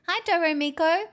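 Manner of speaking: high, loud cheerful hello; excited greeting. Real, not acted. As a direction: call out the hello.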